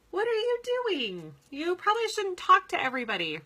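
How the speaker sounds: a lightly voiced husband, high and incredulous; mock husband. Brad scolds his wife for chatting with strangers, lightly mock incredulous. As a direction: play the baffled husband lightly, voice rising. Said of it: "Brad would be like".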